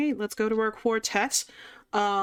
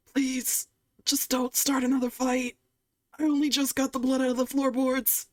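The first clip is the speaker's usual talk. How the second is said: high, pleading, hurried dwarf; pleading dwarf barkeep; 6 semitones higher than usual. Acted. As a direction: beg them not to brawl again. Will begs party not to fight.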